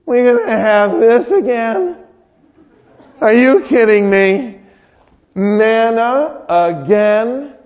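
high, drawn-out mock whine; whining. Israelites grumbling about another manna meal, whining. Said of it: "They whined".

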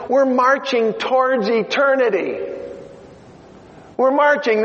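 rally the congregation, push it out; preaching at full force. Real, not acted. Preacher urging the congregation to think eternally, full force.

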